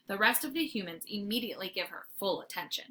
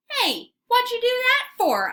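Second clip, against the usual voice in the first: very high, loud protest; startled dog protest; indignant yelp; 14 semitones higher, 8 dB louder than usual. A narrator's reading. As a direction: yelp in surprise. Dog yanked by collar.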